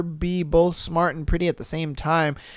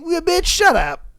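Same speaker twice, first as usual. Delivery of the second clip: mock snap; 9 semitones higher than usual.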